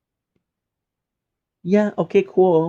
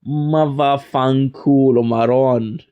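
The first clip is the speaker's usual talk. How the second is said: Italian curse impression; 3 dB louder, slower than usual. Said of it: "So you tell them".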